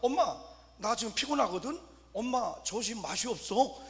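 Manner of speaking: mock baby talk. Two-month-old baby talks to mom, mock baby voice. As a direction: play a baby speaking far too fluently. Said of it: "낳은 지 이 개월도 안 됐는데 얘가".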